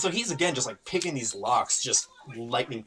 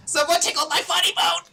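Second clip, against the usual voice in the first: much higher, louder comic yell; shouted punchline; 7 dB louder than usual. Acted. Skeleton apologizes then springs a pun, yelling gleefully. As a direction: burst out with the pun.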